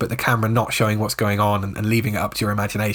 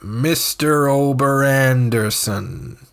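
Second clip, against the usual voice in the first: slow, drawn-out name announcement; mock-grand greeting; 3 semitones higher, much slower than usual. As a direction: stretch the name out playfully like an introduction. Playful greeting opening his reply.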